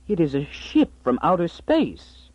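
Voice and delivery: awed wife. Acted. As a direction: gasp it out in wonder. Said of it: "She snuffled".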